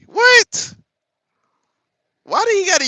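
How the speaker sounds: squeaky high, loud disbelief; shocked what. Real, not acted. Streamer learning the word means angry, shocked squeak. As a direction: shriek it in disbelief. Hearing the word's meaning.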